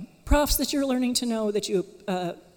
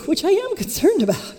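laughing; 11 semitones higher, 7 dB louder than usual. Real, not acted. Joking aside about students' sleep.

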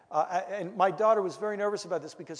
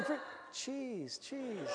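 much softer, higher, slowing voice; dog's thoughts; 5 semitones higher, 11 dB quieter, slower than usual. Retold or imitated. Runaway dog pondering the cheese, soft high mock musing. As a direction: imitate a dog softly tempted by food. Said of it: "you know, thinking".

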